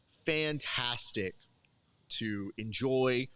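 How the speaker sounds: drawn out. Real, not acted. Savoring the weekend.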